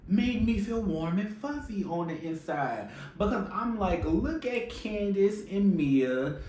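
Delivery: high, delighted gush; fond gushing. Real, not acted. Gushing over friends having fun.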